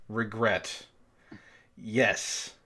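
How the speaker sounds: ordinary delivery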